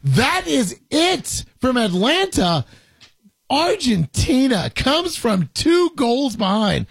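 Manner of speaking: high, drawn-out final-whistle call; match commentary. Real, not acted. Commentator calling Argentina's comeback from two goals behind, dramatic announcement. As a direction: announce the comeback slowly, stretching key words.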